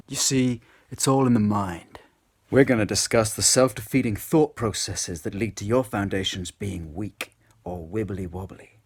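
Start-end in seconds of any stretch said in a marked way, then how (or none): none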